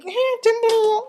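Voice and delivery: high laughing outburst. Real, not acted. Telling the hosts about her ID, laughing high.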